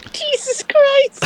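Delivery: startled. Real, not acted. Shocked by sudden game noise.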